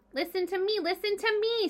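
high honking goose, insistent; goose screaming. A narrator's reading. Goose begs Wilbur to listen, high honking squawk. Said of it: "screamed the goose".

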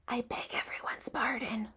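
soft whispered apology. A narrator's reading. Wilbur apologizing to the animals, whispered. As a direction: whisper it meekly. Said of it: "whispered Wilbur".

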